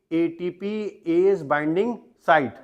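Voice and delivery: each word drawn out slowly for notes; slow dictation. Shown as a demonstration. Teacher to class, dictating the term very slowly. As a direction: spell it out slowly so students can write.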